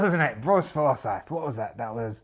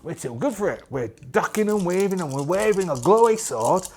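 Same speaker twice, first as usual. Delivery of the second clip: ordinary delivery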